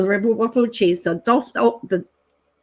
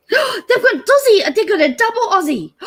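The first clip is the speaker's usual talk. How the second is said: much higher, squealing delight at the find; excited; 12 semitones higher, 5 dB louder than usual. Real, not acted. Finding the double Aussie on the menu, thrilled and squealing. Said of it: "Oh, I'm so excited now".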